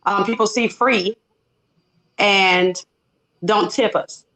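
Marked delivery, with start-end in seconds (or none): none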